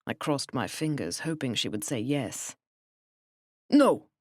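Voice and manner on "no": loud, high, flat German no; emphatic no. Acted. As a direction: shut it down hard. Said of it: "She shook her head emphatically".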